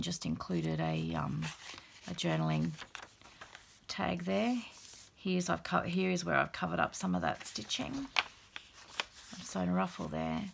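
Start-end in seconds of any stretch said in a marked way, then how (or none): none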